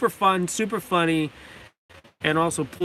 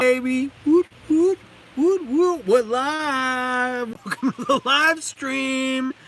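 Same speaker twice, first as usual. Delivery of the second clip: hyped going live; 6 semitones higher, slower than usual. Real, not acted.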